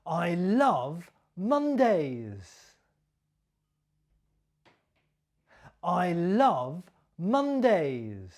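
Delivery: slow, with "Mondays" stressed and falling. Shown as a demonstration. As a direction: land on "Mondays" slowly. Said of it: "my stress and my intonation".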